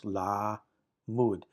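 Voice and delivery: "la mud" split by a pause after la; "la ... mud", one syllable at a time; two separate syllables. Shown as a demonstration. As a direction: say "la", wait, then "mud". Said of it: "This is two syllables".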